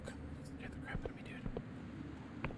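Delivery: barely audible whispered aside. Real, not acted. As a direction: drop right down to a whisper. Trailing into whisper while recounting scare.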